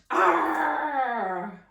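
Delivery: loud fierce pirate arr; pirate growl. Acted. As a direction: roar it out like a pirate on deck. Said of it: "I hope you sound really fierce".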